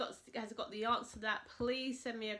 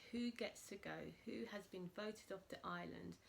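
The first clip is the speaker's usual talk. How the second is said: suspense whisper; 7 dB quieter than usual. Acted. Teasing the elimination suspense.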